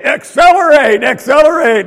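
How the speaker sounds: loud, high mock sports cheer; mimicked yell. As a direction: kids parroting their parent's excited yell. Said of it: "John or Julie would say".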